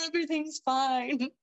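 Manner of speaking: high, pretend-tearful voice after a laugh; mock crying. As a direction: playfully insist through fake tears. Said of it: "like, crying and just like".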